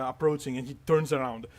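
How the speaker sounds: ordinary talk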